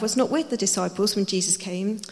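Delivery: normal talking voice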